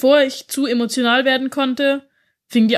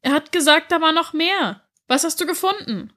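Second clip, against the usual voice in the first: put-on high, pressing child's voice; a young girl pushing for the rest of the story; higher, eager child's question; 7 semitones higher than usual. Child girl urging her uncle.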